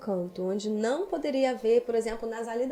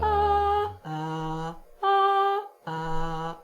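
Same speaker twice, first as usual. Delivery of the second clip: pitch jumps demo; 9 semitones higher, much slower than usual. Shown as a demonstration. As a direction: show the larynx going up and down. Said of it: "Se você fizer assim".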